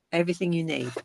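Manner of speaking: ordinary delivery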